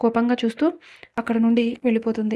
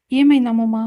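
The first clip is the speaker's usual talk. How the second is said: plain reading voice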